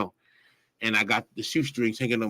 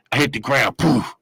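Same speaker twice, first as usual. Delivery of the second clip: ordinary talk, nothing marked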